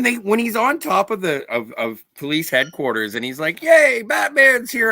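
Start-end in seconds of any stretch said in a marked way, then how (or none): 3.6-4.9 high, drawn-out mock cheer. Mimics excited onlooker at the signal.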